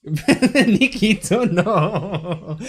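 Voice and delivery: drawn-out laughing protest. Real, not acted. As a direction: laugh through the name. Laughing reaction to chat.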